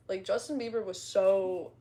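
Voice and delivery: ordinary talk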